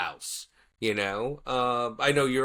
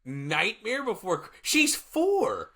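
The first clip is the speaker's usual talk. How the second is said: incredulous; 10 semitones higher than usual. Real, not acted. Cohost reacting to a small child's scary favorite movie, incredulous. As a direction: pitch leaping up in disbelief.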